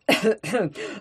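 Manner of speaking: awkward little cough; embarrassed throat clearing. A narrator's reading. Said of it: "смущённо прокашлялся". (смущённое покашливание)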